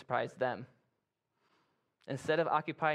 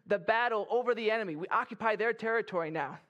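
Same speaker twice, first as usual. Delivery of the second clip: higher, triumphant report to a superior; proud, excited soldier calling it in; elated soldier; 6 semitones higher than usual. Acted. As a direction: report the victory to your officer, thrilled. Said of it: "soldier is feeling really good".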